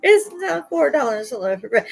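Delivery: high, slowed mock outrage at the price; half-laughing, disbelieving rant; grumbling at the price of a loaf of bread; outraged shopper. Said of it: "I'm like".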